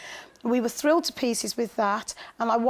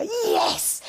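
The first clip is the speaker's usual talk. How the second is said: a high, excited yelp of joy, imitating the children; cheering yes; 13 semitones higher than usual. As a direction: cheer it like a child who just won.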